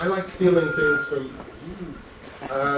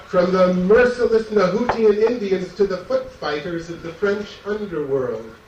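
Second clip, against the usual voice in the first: louder, punchy hard-sell reading broken by laughter; mock ad pitch; 5 semitones higher, 4 dB louder than usual. Poet to audience, reading his magazine-ad poem like a laughing huckster. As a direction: ham it up like a back-of-magazine advert.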